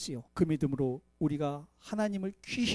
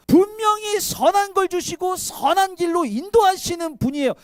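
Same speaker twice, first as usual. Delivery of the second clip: higher and louder, hammering 분명히; emphatic shout; 8 semitones higher, 6 dB louder than usual. Acted. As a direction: preach it out at full force. Repeats the phrase, now shouted.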